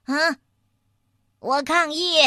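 indignant protest. A narrator's reading. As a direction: protest as if insulted. Mole protests at being insulted.

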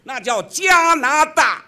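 加拿大 said slow, high and loud, each syllable punched; emphatic stress. Real, not acted. Correcting his partner's stress on 加拿大 with slow emphatic loudness. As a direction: lean hard on 加拿大 to correct him. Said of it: "这逻辑重音问题".

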